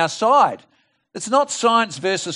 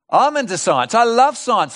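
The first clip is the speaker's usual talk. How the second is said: raised, emphatic delivery; keen enthusiasm; 6 semitones higher, 4 dB louder than usual. Real, not acted. Preacher claiming science for believers, keen and emphatic. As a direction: declare it with lifted pitch and conviction.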